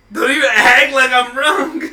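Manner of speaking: loud, slow words through laughter; can barely get it out for laughing; laughing hard. Real, not acted. Cracking up at own joke.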